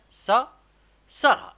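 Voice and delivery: سارة called out high and loud; excited loud reading. Shown as a demonstration. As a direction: sound out سا then shout سارة proudly. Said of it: "on va écrire ça". (قراءة سارة بصوت عالٍ ومرتفع)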